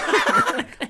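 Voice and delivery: high shrieking maniacal cackle; hysterical laugh. Acted. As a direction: cackle like an unhinged guard. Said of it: "Why are you laughing like that".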